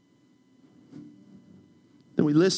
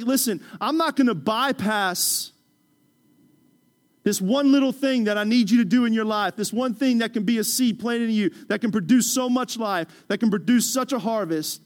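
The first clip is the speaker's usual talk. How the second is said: higher, louder firm voice of God; 5 semitones higher, 6 dB louder than usual. Acted. God answering an impatient believer, firm and raised. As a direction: lay it down firmly as God.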